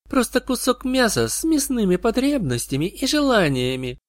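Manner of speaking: high, light seductive creature voice; sweet poison voice. A narrator's reading. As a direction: purr it down from up high.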